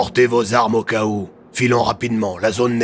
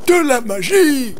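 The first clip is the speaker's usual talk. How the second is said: high, slow, showy reveal; excited exclamation; exclaiming; 14 semitones higher, 5 dB louder than usual. Acted. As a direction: announce it like a magic trick. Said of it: "s'exclama Oswald".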